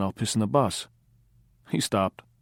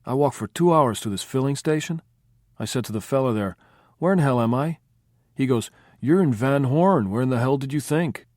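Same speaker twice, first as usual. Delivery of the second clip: Speedy, louder storytelling; 6 semitones higher, 6 dB louder than usual. A narrator's reading. Speedy tells filling station story.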